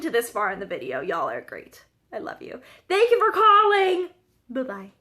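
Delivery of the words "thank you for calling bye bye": high, loud, chirpy call-line voice; mock phone sign-off. Vlogger signing off to viewers, mock high phone-line voice. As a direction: sing out a put-on receptionist's farewell.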